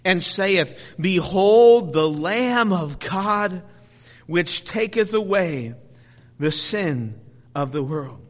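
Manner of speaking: slow, swelling proclamation that settles at the end; proclaiming scripture. Real, not acted. John proclaims the Lamb of God, slow heralding. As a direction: herald it, drawing the words out.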